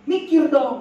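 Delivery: high, loud retort; mock exasperation. One partner scolds the other in quarrel, mock-exasperated.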